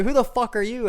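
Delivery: retelling a rage quit, hammed-up yell; retold angry outburst. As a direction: blow up at a stranger in the lobby. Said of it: "I got so angry".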